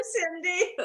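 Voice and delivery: ordinary talk, nothing marked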